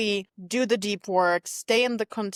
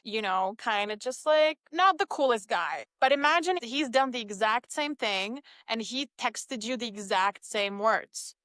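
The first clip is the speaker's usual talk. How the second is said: ordinary delivery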